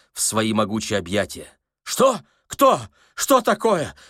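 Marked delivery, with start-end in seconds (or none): 1.8-4.0 high, loud startled questions; alarmed cry. A narrator's reading. Startled old man grabbed by a stranger, high alarmed cries. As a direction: yelp each question in panic. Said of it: "закричал беспокойный старик".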